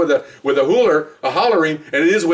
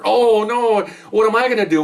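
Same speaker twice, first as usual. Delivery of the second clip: higher-pitched mock helpless wail; mock breakup despair; 6 semitones higher than usual. Golf partner being dropped by his teammate, mock despair. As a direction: play up being abandoned.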